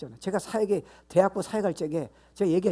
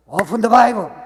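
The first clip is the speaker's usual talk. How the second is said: loud, raised preacher's exhortation; emphatic command; 5 semitones higher, 13 dB louder, much slower than usual. Real, not acted. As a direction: belt it out as an order to the congregation. (큰 소리로 힘주어 명령하듯)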